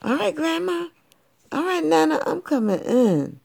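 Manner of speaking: put-on very high sweet girl voice; high little girl. A narrator's reading. Girl answering Grandma at the door. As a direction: answer politely, little and bright.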